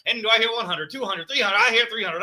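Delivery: auctioneer. Retold or imitated. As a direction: rattle off the bids like an auctioneer. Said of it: "now I'm doing an auctioneer".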